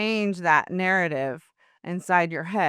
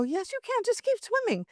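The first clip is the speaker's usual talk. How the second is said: very high, light sing-out of encouragement; mock-chirpy pep talk; retold coaxing; 3 dB quieter than usual. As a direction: coax a nervous friend in a high, softer voice. Retold coaxing of a scared friend.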